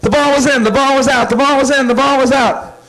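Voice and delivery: put-on high, sing-song kid argument; childish bickering. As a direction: bicker like kids on a playground.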